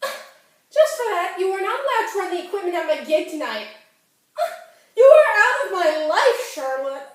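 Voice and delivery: put-on high, loud teenage fury over the phone; angry outburst. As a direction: cut your friend off in a loud, rising rage. Acted furious phone quarrel with Charlotte.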